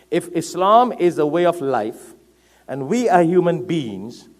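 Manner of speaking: ordinary talk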